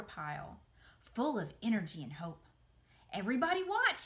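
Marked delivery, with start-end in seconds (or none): 3.1-4.0 high, loud excited call; crying out. Acted. Wilbur calls everyone to watch, loud excited cry. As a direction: announce it proudly to all. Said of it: "he cried".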